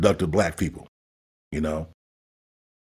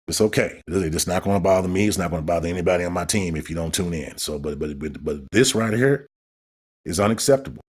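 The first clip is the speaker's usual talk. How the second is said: ordinary delivery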